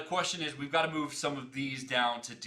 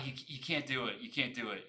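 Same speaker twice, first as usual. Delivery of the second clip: ordinary delivery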